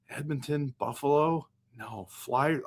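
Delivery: ordinary delivery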